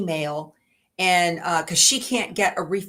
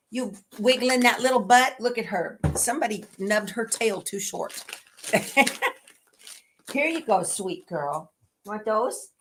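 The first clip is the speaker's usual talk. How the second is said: usual speaking voice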